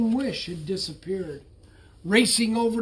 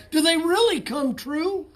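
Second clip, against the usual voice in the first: high, breathless hopeful question; eager question; 9 semitones higher, 4 dB louder than usual. A narrator's reading. Bullfrog asking the wizard hopefully, high eager voice. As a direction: ask it wide-eyed with hope.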